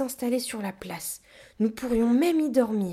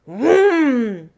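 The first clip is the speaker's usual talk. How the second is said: loud, high revving noise; imitated engine roar; 5 semitones higher, 12 dB louder than usual. A narrator's reading. Gorilla hits the gas, loud imitated engine roar. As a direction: rev up like a roaring motor.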